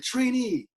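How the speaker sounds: high-pitched mock yell at a recruit; imitated instructor's call; drill instructor calling out.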